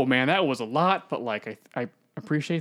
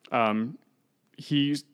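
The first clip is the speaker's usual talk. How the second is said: ordinary delivery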